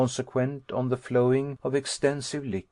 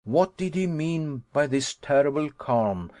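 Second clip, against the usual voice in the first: ordinary delivery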